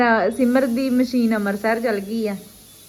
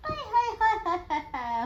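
doting coo; 9 semitones higher, 3 dB quieter than usual. Real, not acted. Doting over the baby.

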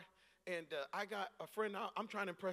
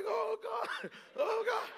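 high, frantic mock panic at the sirens; retold terror, pitched way up; panicked cry; 13 semitones higher, 4 dB louder, much slower than usual. Teen driver panicking as police close in, frantic. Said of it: "And so I'm like".

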